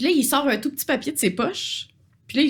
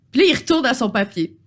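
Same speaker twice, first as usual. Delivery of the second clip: normal talking voice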